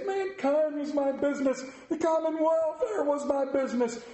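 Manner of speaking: high, grieving phantom; lamenting ghost. Acted. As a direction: pour out the regret. Ghost laments his life.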